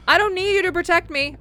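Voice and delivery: indignant yell. Acted. Player snapping at Reese about protection, loud. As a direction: snap it back.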